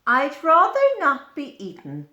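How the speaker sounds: a stick insect boasting of its disguise; stick insect character. Acted. Stick insect speaks in the book.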